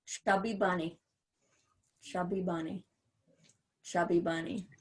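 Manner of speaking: muffled through a full mouth. Real, not acted. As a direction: mouth stuffed with marshmallows. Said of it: "another hand of marshmallows".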